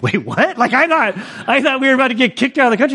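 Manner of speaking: high-pitched, rushed disbelief; retold alarm. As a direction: blurt it out in mock alarm. Retelling his alarm at argument.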